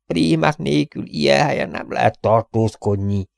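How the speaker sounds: a lightly voiced wheezing old actor; wheezy hoarse old man. Freezing old Barker complaining to Robin, lightly wheezy voice. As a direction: groan it out, worn and frozen. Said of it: "sipoló, reket hangon beszélt".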